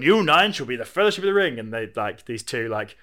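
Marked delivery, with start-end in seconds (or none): 0.0-1.6 loud, higher solemn pronouncement; grand proclamation. Acted. Elrond naming the Fellowship, loudly proclaiming. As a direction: proclaim it like a lord to his council. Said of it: "And he's gone".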